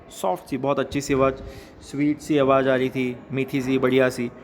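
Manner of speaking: normal talking voice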